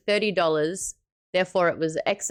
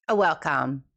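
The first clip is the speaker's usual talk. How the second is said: ordinary delivery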